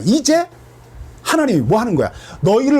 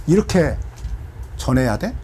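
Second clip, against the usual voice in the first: usual speaking voice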